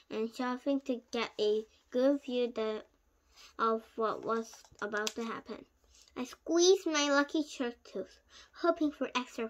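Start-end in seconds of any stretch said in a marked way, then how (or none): none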